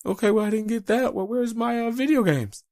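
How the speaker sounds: put-on high, demanding kid voice; mock daughter whining for video games; whiny kid voice. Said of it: "she's gonna be like".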